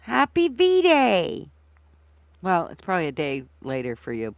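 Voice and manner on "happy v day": slow, high stretched greeting; drawn-out greeting. Real, not acted. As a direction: draw out the greeting happily.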